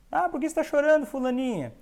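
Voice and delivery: lightly played grown-up questioning a sad child; adult asking a child. Adult asks crying child.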